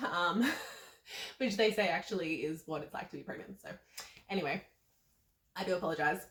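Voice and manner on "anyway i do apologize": normal talking voice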